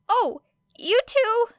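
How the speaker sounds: put-on high, slow, earnest young man; bright and eager reply; high-voiced sincere teen boy; cheerful nervous boy. A narrator's reading. Midoriya answers good luck.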